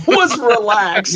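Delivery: amused mock echo; laughing repeat. Real, not acted. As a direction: savour the phrase through a laugh. Mocking repeat of friend's phrase.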